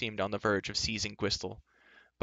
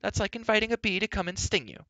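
put-on high, loud, indignant girl; exasperated high-pitched scolding; impatient outburst; 12 semitones higher, 5 dB louder than usual. A narrator's reading. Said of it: "unable to curb her impatience".

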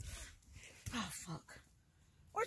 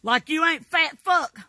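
slow, loud insult thrown back; slow angry retort; 4 semitones higher, 7 dB louder, slower than usual. Real, not acted. Grandma trading insults with her grandson.

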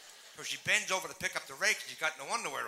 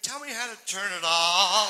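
put-on loud, slow, desperate old lady plea; old lady begging the clerk for help, loud and slow; exasperated old lady; 4 semitones higher, 10 dB louder, slower than usual. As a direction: shout it out, slow and fed up.

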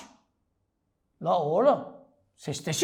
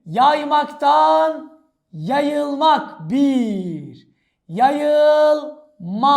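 raised voice with long-held endings; mock proclamation; 3 semitones higher, 4 dB louder, slower than usual. Teacher counts suffixes for students, raised drawn-out voice. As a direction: call out each step like an announcer. Keeps booming until calming down.

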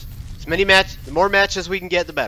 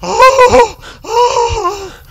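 high, loud wails of fright; shaken cry; 12 dB louder than usual. Real, not acted. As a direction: wail out after a nasty scare. Reacting after game scare.

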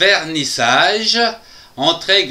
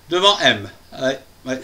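normal talking voice

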